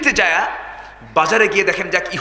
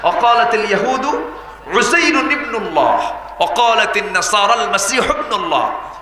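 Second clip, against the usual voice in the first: Quranic recitation; 3 semitones higher than usual. Acted. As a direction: chant the verse like a reciter. Quotes Quran verse in Arabic.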